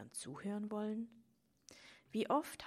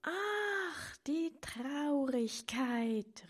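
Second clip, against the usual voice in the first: much higher and louder, slow joyful cry; delighted exclamation; 8 semitones higher, 8 dB louder, much slower than usual. A narrator's reading. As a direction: greet it like a dear old friend. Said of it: "rief die kleine Frau erfreut aus".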